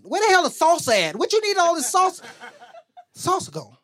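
high-pitched mock outrage; exasperated parent. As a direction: complain high, as if the food has vanished. Parent complains kids ate everything.

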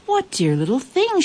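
high-pitched cooing queen; delighted cry. A narrator's reading. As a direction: exclaim over adorable little creatures. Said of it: "she cried".

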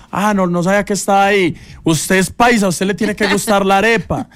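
Diana teasing. Retold or imitated. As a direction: tease him about being paisa.

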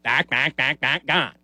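quacking backs rising, then gone; duck-like Berman call. Retold or imitated. Crew mocking the broadcaster's call as a duck, quacking. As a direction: make the call sound like quacking. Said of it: "he sounded like a duck".

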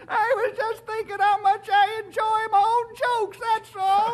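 high voice bubbling over with laughter; laughing old-timer. Acted. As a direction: giggle it out, tickled by your own joke. Old-timer laughing at his own joke.

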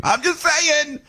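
a high, playful "I'm just saying"; sing-song repeat. Real, not acted.

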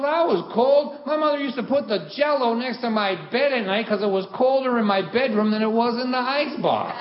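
high, worked-up father impression; loud-ish, high comic tirade; ranting dad. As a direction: pile on the hardship as a guilt-tripping dad. Dad's rant about a cold childhood.

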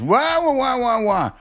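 high whining wah wah, mimicking the doorman; hammed-up imitation of a whining attendant; mocking whiny guy. Imitating the stadium guy's whining to friends. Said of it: "This is how it sounds".

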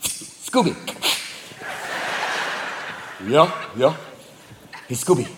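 low, hushed bedtime dialogue; scheming cat and dog. Acted. Cat quietly needles sleepy dog, low hushed voices. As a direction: keep it low and soft, secretive. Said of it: "the cat's like".

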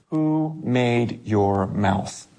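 slow and weighty, each word given space; slow solemn God. Acted. God asking Moses slowly, solemn and weighty. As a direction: let God's question land slowly.

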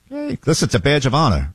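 ordinary delivery

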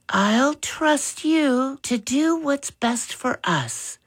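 brightened. A narrator's reading. Mother to father, trusting him to decide, brightened. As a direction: lift the voice brightly to show trust. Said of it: "Mother's voice brightened".

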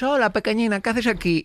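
saludo agudo y mimoso imitado; hablándole a una mascota. Acted. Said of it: "en una mascota". (vocecita aguda para hablar con mascotas)

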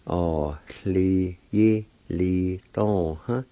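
"Oh, li, yi, li, dong, huh" with short gaps; measured "Oh, li, yi, li, dong, huh", syllables separated; broken into syllables. Shown as a demonstration. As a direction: sound out "Oh, li, yi, li, dong, huh" part by part. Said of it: "It's pronounced like this".

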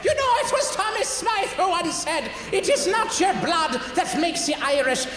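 high-pitched comic Irish voice; Irish accent. Shown as a demonstration. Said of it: "if you want to go from Newfie to Irish, here's what you do".

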